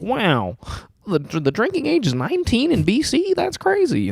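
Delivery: very high-pitched starry-eyed wonder; mock amazement. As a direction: squeal it like an overawed tourist. Retelling own amazed reaction.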